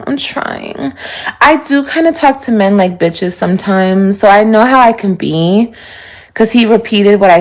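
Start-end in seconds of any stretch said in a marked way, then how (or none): 0.1-0.9 soft resigned murmur. Real, not acted. Sighs, says she's trying.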